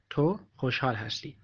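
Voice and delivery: ordinary talk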